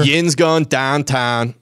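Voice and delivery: slow Pittsburgh Yinzer accent. Shown as a demonstration. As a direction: drawl it slowly like a Pittsburgh local. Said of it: "What do they say about Yinzers".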